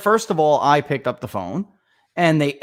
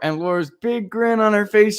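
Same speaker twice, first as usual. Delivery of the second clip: ordinary talk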